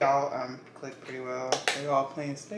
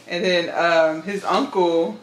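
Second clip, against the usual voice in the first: ordinary talk, nothing marked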